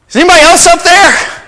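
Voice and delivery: high, loud, fast yell upward. Retold or imitated. Joe yelling for anyone but God, loud and high. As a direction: yell out in a panic. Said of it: "Joe yells out".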